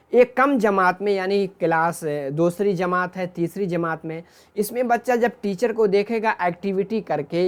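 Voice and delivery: ordinary talk, nothing marked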